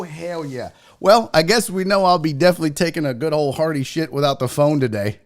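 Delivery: ordinary talk, nothing marked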